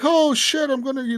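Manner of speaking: high-pitched imitation of a caught man; mock alarm. Imitated smuggler caught with contraband, mock alarm. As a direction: play at being alarmed, pitch jumping up.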